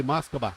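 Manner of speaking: "Moskova" said as mos-ko-BAH; Russian name for Moscow. Shown as a demonstration. Co-pilot correcting the captain on the name, "Moskova" as mos-ko-BAH. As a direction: say "Moskova" in three syllables, v near a b. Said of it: "it's actually Moskova".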